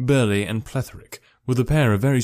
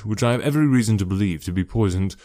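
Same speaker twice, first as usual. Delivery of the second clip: plain reading voice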